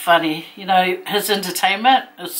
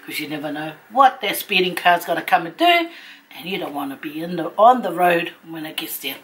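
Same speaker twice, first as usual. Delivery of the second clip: normal talking voice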